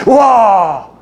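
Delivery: acted elated outburst of an older relative; Elizabeth's joyful cry. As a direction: exclaim the blessing in awe. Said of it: "엘리사벳을 통해서요".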